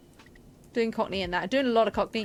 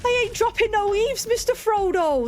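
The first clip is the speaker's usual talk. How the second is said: West Country Samwise line, pitched up; West Country accent; 10 semitones higher, 3 dB louder than usual. Shown as a demonstration. Streamer warming up her West Country accent for the play. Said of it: "I say".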